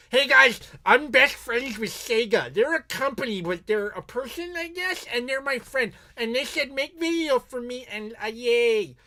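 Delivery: high, put-on goofy voice; hammed-up high dopey voice; mock dopey fanboy. Parodies himself as deluded fanboy.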